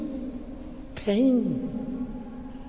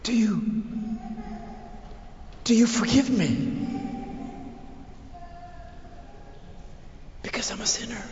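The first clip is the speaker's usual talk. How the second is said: fervent prayerful preaching; 2 semitones higher than usual. Acted. Preacher questioning Jesus as a sinner, pleading. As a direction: plead with Jesus, swelling then dropping back.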